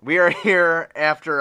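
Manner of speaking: forced cheery, loud; fake optimism. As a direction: pump it up falsely bright. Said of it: "The optimism in my voice is that I'm being fake as fuck".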